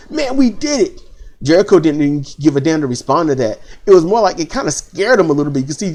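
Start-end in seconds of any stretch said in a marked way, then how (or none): 0.1-1.0 high, hyped teammate shout; teammate cheering. Retold or imitated. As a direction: jubilant pal slapping his back.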